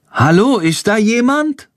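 tentative, high-pitched call; cautious call. A narrator's reading. Boy calls into a stable to see who is there, cautious. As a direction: call out carefully, voice lifting high. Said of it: "ruft Jendrik vorsichtig".

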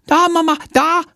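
put-on high, bawling toddler voice; plärrendes Kleinkind. A narrator's reading. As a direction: bawl it out like a little girl. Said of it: "plärrt sie". (plärrendes Kleinkind, hoch)

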